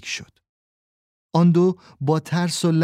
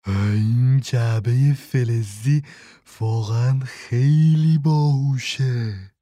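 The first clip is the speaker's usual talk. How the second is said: admitting; 2 semitones lower, much slower than usual. A narrator's reading. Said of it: "اقرار کرد و گفت".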